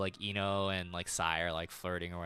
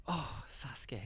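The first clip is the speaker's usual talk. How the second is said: a soft, dreamy sigh of his name, much quieter; lightly mock lovesick girl; swooning Sakura; 4 semitones higher, 9 dB quieter, slower than usual. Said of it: "then she's like".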